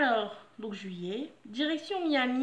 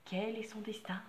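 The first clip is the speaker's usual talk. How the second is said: teasing whisper; 2 semitones lower, 8 dB quieter than usual. Acted. Teasing suspense to viewers.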